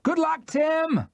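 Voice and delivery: drawn-out cheer; shouted farewell. Acted. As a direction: call after the boat.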